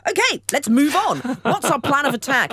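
brisk, high, loud take-charge voice; rally the troops with gusto; rallying call. Acted. Acted brisk rallying to action.

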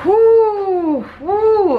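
high loud whoo; excited whoop. Real, not acted. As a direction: whoop with joy. Cheering at the pool.

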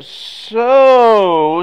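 long drawn "So" with a dip and rise; stretched out so. Retold or imitated. Wife starts to get his attention, a drawn-out "So". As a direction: drag "So" out as a warning. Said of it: "stretched out like that".

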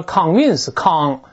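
"convince" with the first syllable like Chinese 康; slow wrong "convince", "con" turned into 康; mispronounced convince. Shown as a demonstration. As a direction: say "convince" wrongly, opening on a drawn-out "kang". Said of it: "容易把它误读成健康的康".

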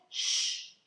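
sharp, voiceless shush. A narrator's reading. James silencing the earthworm as the bird nears, shushing. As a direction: hush someone urgently.

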